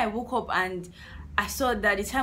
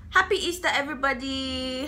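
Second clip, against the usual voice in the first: festive call-out; 6 semitones higher than usual. Real, not acted. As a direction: call out holiday wishes to a crowd. Calls out greeting to viewers.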